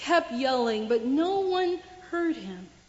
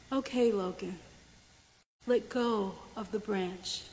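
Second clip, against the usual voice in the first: softer, low and steady; calm instruction; 5 semitones lower, 7 dB quieter than usual. Acted. God calmly tells the man to let go, softer. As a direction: gently tell him to let go.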